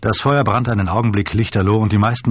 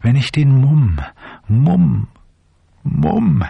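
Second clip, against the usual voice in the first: MUMM pushed higher each time; insistent repetition; 6 semitones higher, 3 dB louder, much slower than usual. A narrator's reading. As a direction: hammer Mumm with a high, falling wail. Drunk or despairing repetition, rising.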